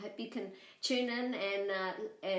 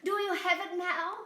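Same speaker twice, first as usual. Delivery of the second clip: high bleating lamb voice, louder; 11 semitones higher, 5 dB louder than usual. Acted. Lamb tricking the coyote, high bleating voice. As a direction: bleat the words like a little lamb.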